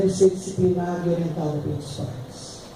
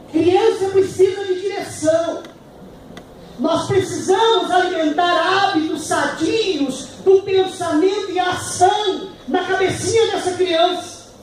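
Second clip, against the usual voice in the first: fiery preaching; 10 semitones higher, slower than usual. Acted. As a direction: drive it home to the congregation. Preaching at full force.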